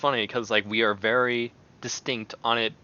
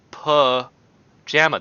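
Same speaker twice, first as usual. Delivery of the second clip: ordinary talk, nothing marked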